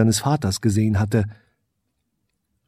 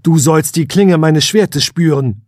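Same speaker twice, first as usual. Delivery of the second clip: defiant challenge; 5 semitones higher, 6 dB louder than usual. Acted. Prince challenges unseen foe.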